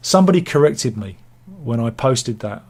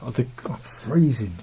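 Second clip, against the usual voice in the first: cold and shivering, words a bit tense; 3 dB quieter than usual. Real, not acted. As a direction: voice tight from the cold. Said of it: "I'm cold".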